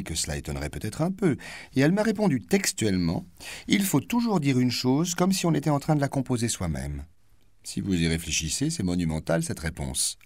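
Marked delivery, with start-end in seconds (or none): 3.7-7.0 raised, lightly mocking quoted line; quoted maxim, voice raised; voiced quote. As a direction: quote her pompous maxim with a lift. Quoting the actress's words.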